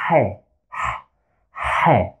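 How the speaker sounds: H breathed from the throat, like a hoarse ha; H pushed as a breathy throat sound; Arabic throat H. Shown as a demonstration. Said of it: "We pronounce it as".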